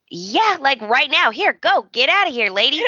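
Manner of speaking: urgent shooing. Retold or imitated. Liam shooing Brooke out.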